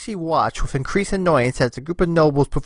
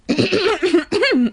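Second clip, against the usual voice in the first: high, pointed ahem for attention; demanding attention from the nobles; loud throat clearing; 3 dB louder than usual. Acted. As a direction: clear your throat to silence a crowd. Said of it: "clearing her throat loudly".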